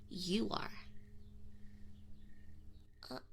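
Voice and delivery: ordinary talk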